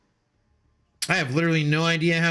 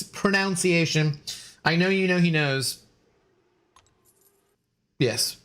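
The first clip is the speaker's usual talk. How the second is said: ordinary delivery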